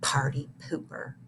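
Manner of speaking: very soft, under the breath; girl muttering under her breath. A narrator's reading. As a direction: grumble it so the teacher can't hear. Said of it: "muttered Victoria under her breath".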